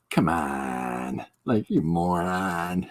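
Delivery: low, unimpressed come on, then a slow you moron; dismissive scoff. Retold or imitated. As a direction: wave off a fool. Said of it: "such a dismissal".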